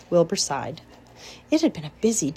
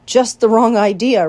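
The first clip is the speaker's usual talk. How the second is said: "Just the wrong idea" said louder and decisive; firm rejection; 4 semitones higher, 10 dB louder than usual. A narrator's reading. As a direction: shut the idea down firmly. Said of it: "replied Charlotte".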